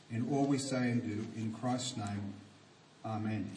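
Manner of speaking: low, measured prayer voice; public prayer. Real, not acted. Preacher thanks God before the sermon, in prayer.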